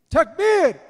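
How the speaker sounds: high, very loud call to the crowd; rallying shout. Real, not acted. Host calling the audience to respond at the close, rallying shout. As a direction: shout it out high to rouse everyone.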